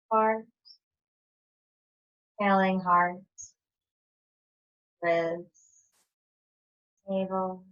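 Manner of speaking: calm, measured breath cues with long pauses; even, low-key yoga teacher voice; guided breathing. Acted. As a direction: pace the listeners' breath, one short phrase at a time.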